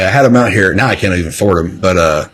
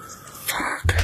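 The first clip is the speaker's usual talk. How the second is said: hushed, drawn-out curse under the breath; voiceless whispered expletive; whispered dismay; 17 dB quieter than usual. Retold or imitated. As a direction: whisper it as if sunk by bad news. Retelling dismay at cancellation.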